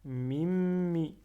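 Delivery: MIMMI with a long, loud held M; doubled M. Shown as a demonstration. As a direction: press and hold the middle M of "Mimmi". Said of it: "Je fais shadda sur le mim". (m du milieu appuyé et prolongé dans « Mimmi »)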